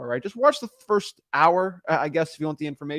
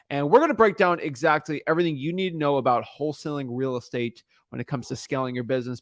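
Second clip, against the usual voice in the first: ordinary talk, nothing marked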